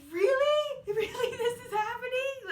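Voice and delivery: very high, loud squeal of disbelief with a laughing, teary edge; freaking out. Retold or imitated. Impersonating the bride's shocked reaction on the show, squealing. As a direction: imitate her overwhelmed shriek at the proposal. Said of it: "she was freaking out".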